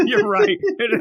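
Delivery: laughing admission, high and loud. Real, not acted. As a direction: laugh it out, caught being wrong. Laughs at own mistake.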